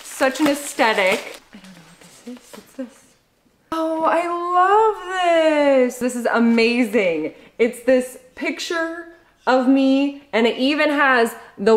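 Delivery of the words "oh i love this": high, drawn-out squeal of delight; delighted gushing. Real, not acted. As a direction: gush with real delight, stretching the words. Delighted reaction to a gift.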